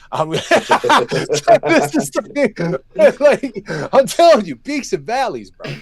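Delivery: high, laughing through the words. Real, not acted. Fighter laughs at his own pre-fight panic, high and laughing. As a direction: break up laughing mid-sentence, slowly.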